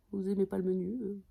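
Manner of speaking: normal talking voice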